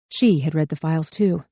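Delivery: ordinary delivery, nothing marked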